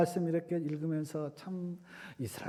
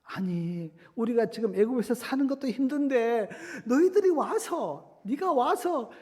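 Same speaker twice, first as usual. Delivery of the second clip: high-pitched acted grumbling, getting louder; building protest, blaming as it climbs; resentful complaint; 7 semitones higher, 5 dB louder than usual. Israelites meeting Moses on the road, resentfully complaining. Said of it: "모세를 원망합니다".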